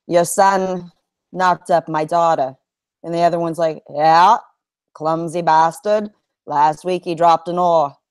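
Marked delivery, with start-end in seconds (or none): 0.1-2.5 put-on old Vermont man, R's dropped; deadpan old Vermonter's accent, halting. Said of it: "the only Vermont joke". 3.9-8.0 put-on old Vermonter's drawn-out reply; deadpan Vermont accent, R's dropped. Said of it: "the two old men".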